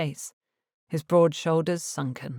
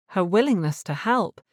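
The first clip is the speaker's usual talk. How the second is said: ordinary delivery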